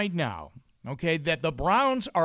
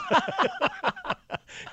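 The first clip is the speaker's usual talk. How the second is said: laughing. Real, not acted. Laughs at own joke.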